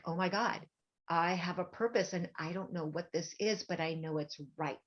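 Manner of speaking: soft, breathless, whispered wonder; hushed awe. Retold or imitated. Thinking to herself driving home, hushed whisper. Retelling inner thought.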